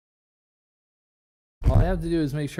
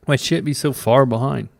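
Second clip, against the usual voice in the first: much louder frustrated complaint; 10 dB louder than usual. Real, not acted. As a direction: call it out annoyed. Loud complaint about lag.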